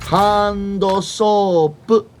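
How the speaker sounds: very slow, drawn-out Japanese-style hando soap; 'hando soap' stretched syllable by syllable like Japanese; Japanese accent. Retold or imitated. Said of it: "the proper Japanese pronunciation".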